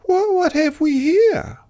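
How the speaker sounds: high, fluttery lady's voice; fluttery Emu. Acted. Emu flutters over the brew at Dingo. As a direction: flutter like a vain bird.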